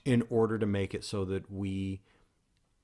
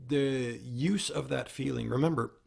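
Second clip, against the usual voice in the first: ordinary talk, nothing marked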